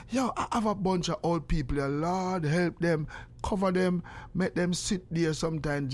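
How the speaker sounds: ordinary talk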